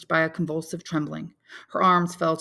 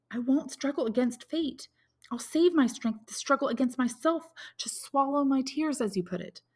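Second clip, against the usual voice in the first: put-on higher, distressed young woman's voice; young woman Valentine; 7 semitones higher, 4 dB louder than usual. A narrator's reading. Valentine confides her bad news to Maximilian, anxious and higher.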